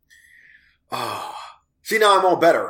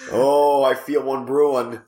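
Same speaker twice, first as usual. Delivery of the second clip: normal talking voice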